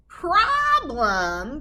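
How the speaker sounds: loud rising scoff; mock surprise. Witch scoffing at the wolf's worry. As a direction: toss the word back.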